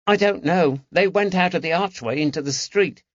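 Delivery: put-on high London boy's voice; the lad proudly reporting clues; eager boy. A narrator's reading.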